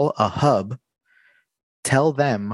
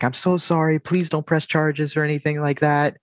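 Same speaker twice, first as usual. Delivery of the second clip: higher, lightly voiced pleading wife; 6 semitones higher than usual. Wife begging the worker not to press charges, higher voice. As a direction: mimic the apologetic wife begging. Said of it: "she's like".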